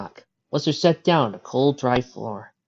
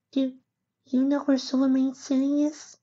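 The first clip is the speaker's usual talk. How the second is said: very high, small filly voice; hopeful little filly. A narrator's reading. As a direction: ask hopefully as a tiny filly.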